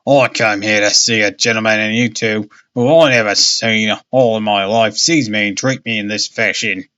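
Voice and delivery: lightly voiced old sailor, indignant; an old sailor stamping his stick in mock outrage; old seafarer crying out. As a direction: protest like a cranky old seaman. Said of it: "he cried".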